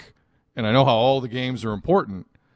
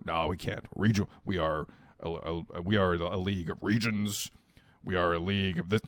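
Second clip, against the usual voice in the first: stuffy league official; 5 semitones lower, 8 dB quieter than usual. Retold or imitated. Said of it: "everyone in the league office laughed".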